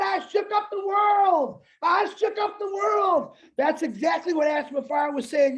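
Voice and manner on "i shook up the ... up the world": high, slow triumphant proclamation; Muhammad Ali. Retold or imitated. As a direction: cry it out like a boxing champion after a win. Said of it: "Muhammad Ali, once he beat".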